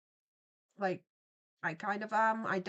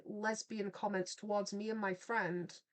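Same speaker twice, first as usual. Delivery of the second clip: ordinary talk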